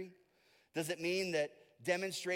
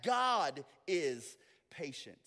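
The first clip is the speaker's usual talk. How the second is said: usual speaking voice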